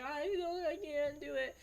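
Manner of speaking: high, mock-whiny kid voice; worked-up child. Kindergartner getting upset again, mock whining. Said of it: "started to get worked up again".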